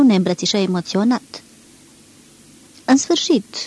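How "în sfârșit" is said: higher, joyful exclamation; exclaiming. A narrator's reading. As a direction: cry out in relief. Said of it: "exclamă el".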